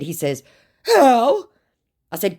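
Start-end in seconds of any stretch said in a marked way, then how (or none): 0.8-1.5 high, loud put-on question; mock baffled scammer. Acts scammer's baffled reply.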